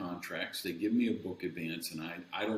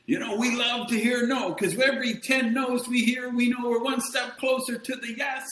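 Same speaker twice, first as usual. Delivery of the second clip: much higher and louder cheery guru voice; mock upbeat guest; 14 semitones higher, 5 dB louder than usual. Mocking the podcast's positive guests, high hammed-up cheer. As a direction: parody a relentlessly positive sales guru. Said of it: "five guests a month who tell you".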